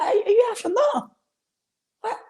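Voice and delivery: high-pitched disbelieving squeak; incredulous. Retold or imitated. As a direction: shriek it in mock disbelief. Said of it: "I'm like".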